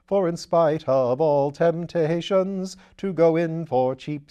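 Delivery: put-on gushing welcome to an old friend; Lord Peter, eager. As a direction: exuberant aristocrat, loud and high.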